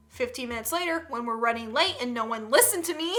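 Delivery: ordinary talk, nothing marked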